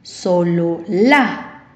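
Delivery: "solo la" slow and low, rising onto a stressed LÁ; stress on last syllable. Shown as a demonstration. Teacher gives an example of agudas, "solo la" with final LA stressed. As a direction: hold back "solo", then push the final "la". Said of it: "última sílaba".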